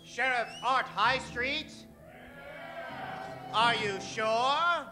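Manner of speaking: usual speaking voice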